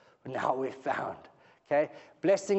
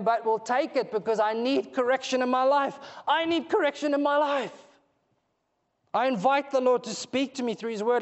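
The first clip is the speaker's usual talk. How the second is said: fervent preaching; 5 semitones higher, 3 dB louder than usual. Acted. Preaching at full force.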